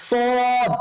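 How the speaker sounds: সদ with heavy s and long rounded vowel; thick sad. Shown as a demonstration. Teacher introduces the next letter, heavy drawn-out সদ. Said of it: "মোটা করে উচ্চারণ করতে".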